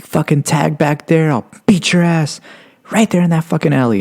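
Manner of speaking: high-pitched mock cop rant; imitated yelling cop. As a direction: threaten as an angry cop, without a full shout. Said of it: "he's, like, yelling at me".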